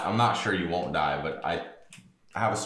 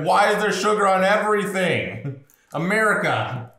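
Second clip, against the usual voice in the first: exasperated yell; 10 semitones higher, 7 dB louder, slower than usual. Retold or imitated.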